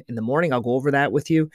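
ordinary talk

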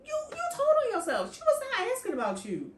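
scolding the knight who confessed without being asked; incredulous. Real, not acted. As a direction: rattle it off in disbelief. Mocking the knight's blunder.

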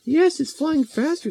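put-on very high, eager girl voice; gleeful little girl Mary Lou. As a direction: squeal the plan like a mischievous kid.

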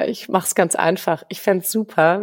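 ordinary talk, nothing marked

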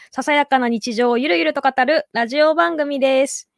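usual speaking voice

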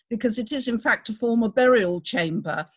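ordinary delivery

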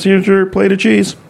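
high-pitched waitress impression; imitated serving lady. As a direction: put on a high voice to serve the plate. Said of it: "She goes".

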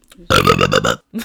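low sultry laugh; a deep seductive chuckle. Shown as a demonstration. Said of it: "Well, insert sound".

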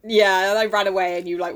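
ordinary talk

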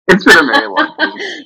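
high laughing voice on "very long week"; laughing admission. Real, not acted. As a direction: repeat it through real laughter, leaning on "very". Repeats it laughing, emphasizing very.

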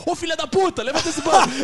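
high, loud mock insult; rude yell. Rude version of the show, loud mock-angry yell. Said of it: "A Praça é grossa".

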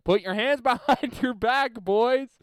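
high, loud retold command; coach barking orders. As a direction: holler it at a line of rookies. Acts the coach giving orders.

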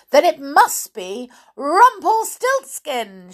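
high, loud, slow reveal; crying out. A narrator's reading. Queen revealing the creature's name, crying out. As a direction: proclaim the name, stretching it out. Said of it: "she cried".

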